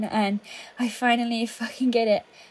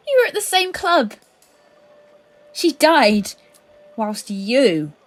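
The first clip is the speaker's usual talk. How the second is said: higher, louder, voice rising in grief and fury; pained, raised accusation; anguished accusation; 4 semitones higher, 8 dB louder, slower than usual. Acted. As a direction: cry the accusation out at him. Accusing him of her friend's death.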